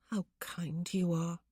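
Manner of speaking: slow, whispered wry thanks. Acted. Wife whispers slowly to her husband, wryly thanking him.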